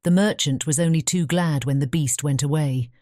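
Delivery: plain reading voice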